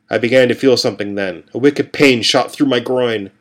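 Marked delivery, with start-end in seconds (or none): none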